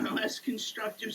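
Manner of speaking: ordinary delivery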